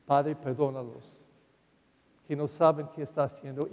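a quiet, tender prayer; tender plea. Retold or imitated. Jesus on the cross asking the Father's pardon, soft and tender.

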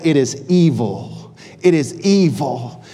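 slow, weighty, each word set down; slow solemn declaration. Acted. Nehemiah to Eliashib, slow solemn verdict. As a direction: declare it is evil deliberately. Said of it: "Nehemiah says".

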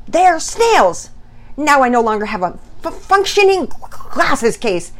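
high, loud sputtering rant; beside himself, stumbling. A narrator's reading. Dad ranting about muddy scissors and snails, sputtering angrily. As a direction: trip over words in acted exasperation. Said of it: "he stumbled over his words".

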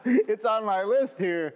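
high, laughing through the words; can't stop giggling while talking. Real, not acted. Host laughing because the guest guessed his next question, real laughter.

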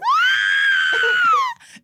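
very high, loud mock scream; horror scream. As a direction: imitate a TV horror victim's long shriek. Imitates TV woman screaming.

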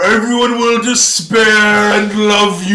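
high, loud filtered voice; voice changer voice. Retold or imitated. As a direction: sound like a face filter voice. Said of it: "Voice changer".

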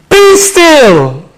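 loud, high divine command; ringing high pulpit shout. Acted. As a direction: shout it as an order from God. Preacher shouts God's command from psalm.

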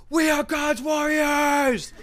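slow, high, drawn-out battle cry; imitated shout. Dad in the middle of the song circle, imitated fervent shout. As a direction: bellow it long and high like a rallying cry. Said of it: "he just shouted".

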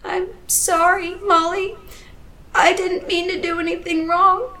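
put-on desperate little kid apologizing; pleading child. A narrator's reading. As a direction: beg forgiveness like a small girl. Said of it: "child pleading for her life".

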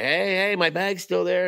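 high-pitched re-enacted yelling for attention; retold scream. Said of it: "I'm screaming at the flight".

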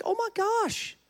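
very high, exasperated retold cry; furious. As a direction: act out fed-up fury in a squeaky high pitch. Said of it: "He's furious".

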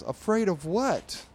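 high, loud, drawn-out question; incredulous challenge. Retold or imitated. Friend snatching Jeremy's phone, loud incredulous retort. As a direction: stretch it out in comic disbelief.

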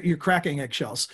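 ordinary talk

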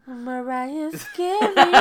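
slow, laughing repeat; giggling while you repeat it. Real, not acted. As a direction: echo the name through laughter.

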